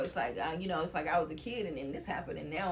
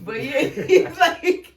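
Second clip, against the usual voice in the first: louder, slow and full of laughter; laughing; 8 semitones higher, 14 dB louder, slower than usual. Real, not acted. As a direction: laugh it out before going on. Laughing at studio story.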